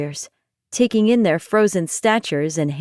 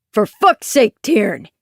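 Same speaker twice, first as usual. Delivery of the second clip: booming call; 4 dB louder, slower than usual. Acted. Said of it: "a booming voice called".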